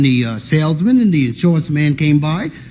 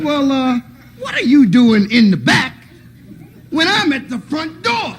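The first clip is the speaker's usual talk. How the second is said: high, slowing disbelieving questions; high incredulous uncle; 9 semitones higher than usual. Retold or imitated.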